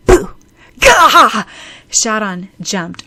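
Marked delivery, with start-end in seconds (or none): 0.1-0.3 sudden high, loud scare shout. A narrator's reading. 0.8-1.4 loud, high yelp of fright; startled yell. A narrator's reading. Startled by a shove and boo.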